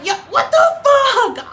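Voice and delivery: very high, laughing squeak; squeaky disbelief. Retold or imitated. Seeing the warning pop up again, squeaky disbelief. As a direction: shriek of disbelief. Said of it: "and I'm like".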